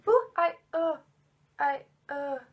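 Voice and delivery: real shudder, voice jumping up; creeped-out groans. Real, not acted. Storyteller shaken by her own ghost story, creeped-out groans. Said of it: "Ej, det var klamt".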